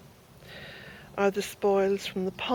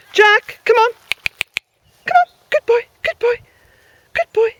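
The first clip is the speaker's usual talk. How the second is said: loud, much higher coaxing call; calling the dog; 14 semitones higher, 12 dB louder than usual. Real, not acted. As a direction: praise and coax the dog over.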